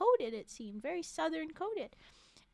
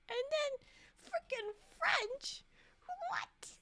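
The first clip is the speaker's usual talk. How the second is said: mock outrage; slower than usual. Outraged at the French pick.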